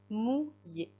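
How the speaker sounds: "Mouillé" split into two beats, mou, then yé; a clear gap inside "Mouillé", between mou and yé; syllable by syllable. Shown as a demonstration. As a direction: break "Mouillé" after mou for dictation. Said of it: "Deux syllabes".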